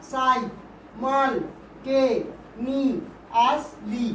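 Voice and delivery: "সাই, মাল, টে, নি, আস, লি" as six separate syllables with pauses. Shown as a demonstration. Teacher counts syllables for pupils, each said separately. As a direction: count out "সাই, মাল, টে, নি, আস, লি" one beat at a time. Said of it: "ছটা syllable আছে।".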